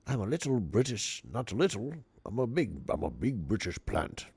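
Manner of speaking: British accent, hammed-up plant; mock British plant. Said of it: "Would he be a little British?".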